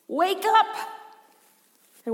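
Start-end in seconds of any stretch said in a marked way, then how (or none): 0.1-0.7 sudden high, raised yell; acted cry to rouse a sleeper; panicked shout. Panicking disciples waking Jesus in the storm, acted shout.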